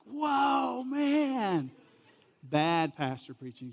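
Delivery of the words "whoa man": high, loud, drawn-out amazed "Whoa, man"; Adam meeting the woman for the first time, amazed. Retold or imitated. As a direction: gasp it out like a stunned Adam seeing her. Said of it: "he was like".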